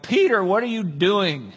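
slow, incredulous telling-off; exasperated rebuke. Retold or imitated. Jesus scolds Peter for the attack, exasperated.

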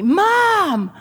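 high, loud mock scream of a furious boy; angry kid scream. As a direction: yell Mom like a mad little kid. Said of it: "he starts screaming at me".

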